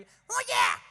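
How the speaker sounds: high, loud hollered answer; shouted reply. Retold or imitated. Boy answering the teacher again, high shout.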